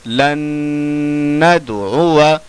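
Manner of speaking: लन नदउआ with a short final अ; लन नदउआ chanted, alif silent; correct recitation. Shown as a demonstration. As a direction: hum the न of लन, end नदउआ short. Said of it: "अलिफ नहीं पढ़ा जाएगा".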